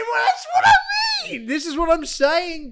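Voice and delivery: high, loud laughing shout; laughing outburst. Real, not acted. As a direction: burst out laughing in mock outrage.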